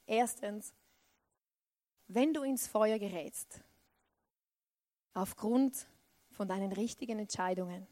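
ordinary talk, nothing marked

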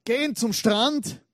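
rising storyteller excitement, bigger at the end; building excitement. Acted. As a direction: pump it up like a storyteller nearing the punchline. Building to the disappointing reveal.

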